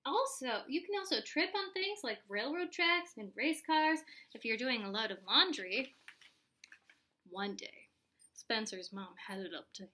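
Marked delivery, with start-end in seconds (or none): none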